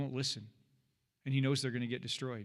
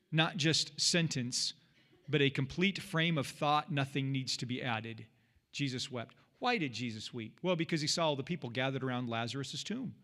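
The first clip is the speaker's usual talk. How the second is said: ordinary talk, nothing marked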